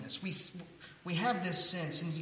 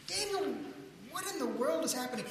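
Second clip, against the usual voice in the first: bewildered; 10 semitones higher than usual. Retold or imitated. Baffled at Daniel's fist.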